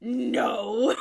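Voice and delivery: mild growl. Acted. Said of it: "Silas growled".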